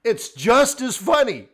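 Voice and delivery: loud, high, slow exclamation; drawn-out, emphatic and loud; big epiphany. Real, not acted. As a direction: proclaim it like a revelation. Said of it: "That was my big epiphany".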